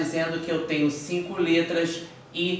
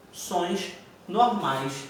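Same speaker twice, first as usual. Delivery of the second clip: normal talking voice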